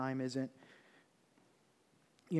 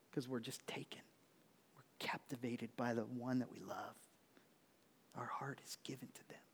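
whisper; 3 semitones lower, 11 dB quieter than usual. Real, not acted. Awed talk of love.

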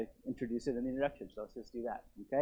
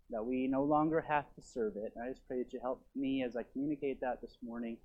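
prayer; 2 semitones lower than usual. Real, not acted. Leads congregation in prayer.

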